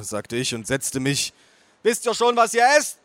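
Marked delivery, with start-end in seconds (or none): 1.8-3.0 high, loud aunt voice; bellowing aunt. A narrator's reading. Aunt hurrying the table to order, bellowing. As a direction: holler the question. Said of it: "brüllte meine Tante".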